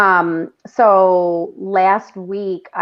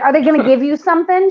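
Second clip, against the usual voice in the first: quick, higher-pitched mild mock yell; retold yell; 6 semitones higher, 4 dB louder, faster than usual. Old lady asking the man about drugs, mildly yelled. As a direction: call it out fast, half imitating her. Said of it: "She, like, yelled it".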